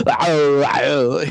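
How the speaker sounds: loud muffled cries of pain; Arnie in a diving helmet. Retold or imitated. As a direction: yelp it out from inside a helmet. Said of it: "can you imagine Arnie's voice inside of a diving helmet".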